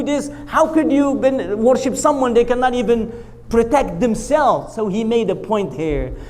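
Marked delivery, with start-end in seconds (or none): none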